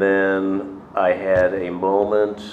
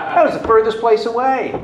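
laughing; 6 dB louder than usual. Real, not acted. Laughing while telling.

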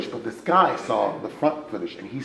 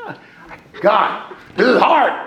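mock complaint; 11 semitones higher, 9 dB louder than usual.

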